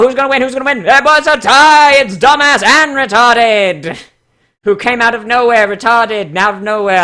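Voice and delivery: loud, high, excited horse race call; race caller. Pretend. Streamer calling the pony bio as a horse race. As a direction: call the finish like a photo finish. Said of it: "horse announcer voice".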